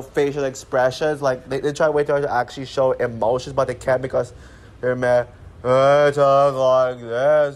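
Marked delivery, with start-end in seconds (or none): 5.6-7.6 a slow, stiff impression of the parents mid-chat; slow cringy parents impression. Vlogger mocking Mel and Mary's stilted talk, slowed mimicry. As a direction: drag it out like the awkward cartoon couple. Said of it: "this is how they converse".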